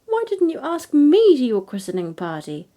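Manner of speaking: loud, high indignant chorus; seven hundred fairies crying out together; cried out. A narrator's reading. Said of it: "they all cried with one voice".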